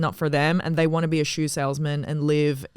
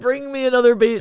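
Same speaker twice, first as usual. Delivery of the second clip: louder echo with 'beach', in a mock Arabic accent; 6 semitones higher, 6 dB louder than usual. Teasing her friend's words, an Arabic-accented mock command. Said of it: "I thought you said".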